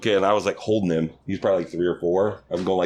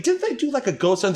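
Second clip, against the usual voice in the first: very high, eager questioning; mock fascination. Man egging on lady's haunted tale, high mock fascination. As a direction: play the amazed, gullible listener.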